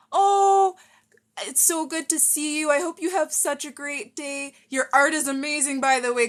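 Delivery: loud, high, delighted hello; gushing greeting. Real, not acted. Streamer spots a viewer whose art they love, gushing.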